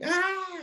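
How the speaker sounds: high, whiny imitated wail; wife mimicking his whine in his sleep, mock baby whine. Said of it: "whining like a baby".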